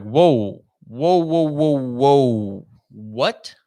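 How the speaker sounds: retold shocked reaction; stunned whoa. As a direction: back off in shock at the news.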